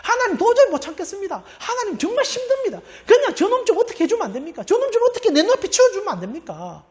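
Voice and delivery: high, loud, fed-up venting at God with a laugh; angry prayer. Retold or imitated. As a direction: pour out the rage and plead, hammed up. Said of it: "오히려 분노를 그대로 쏟아냅니다". (높고 큰 목소리로 분노를 쏟아내는 기도)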